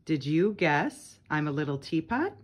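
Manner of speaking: ordinary delivery, nothing marked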